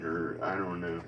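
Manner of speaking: ordinary talk, nothing marked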